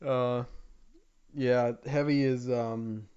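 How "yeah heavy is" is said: ordinary delivery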